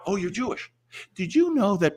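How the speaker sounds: lightly put-on high preachy voice; mock religious pest. Mocking a religious stranger hounding him, mild preachy voice.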